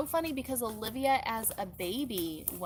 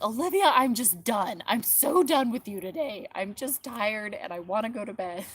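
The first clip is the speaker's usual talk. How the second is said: imitating worn-out kids; 8 dB louder than usual. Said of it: "even the kids are like".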